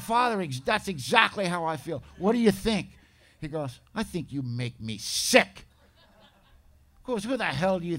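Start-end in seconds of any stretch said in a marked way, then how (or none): 0.0-2.9 high and loud, pushing for sympathy; eager loud plea. Retold or imitated. Recovering addict loudly pleading with his priest for sympathy. 3.9-5.6 slow and louder, each word laid down; stern rebuke. Retold or imitated. Priest bluntly telling the self-pitying man he disgusts him, slow and stern.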